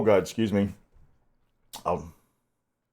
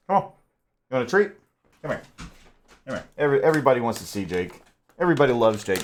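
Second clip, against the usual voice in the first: usual speaking voice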